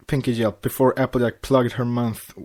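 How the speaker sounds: ordinary delivery, nothing marked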